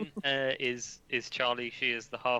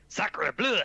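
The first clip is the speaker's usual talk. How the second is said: high, loud hammed-up French accent; mock French exclamation; 9 semitones higher, 5 dB louder than usual. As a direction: burst out as a caricature French character. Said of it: "just goes".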